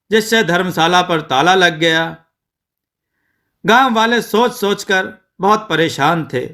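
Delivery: plain reading voice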